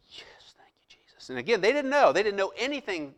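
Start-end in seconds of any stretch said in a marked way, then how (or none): 0.1-1.3 whispered private thank-you; silent thanks. Retold or imitated. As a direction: breathe it out like a secret prayer. I'm standing there thinking.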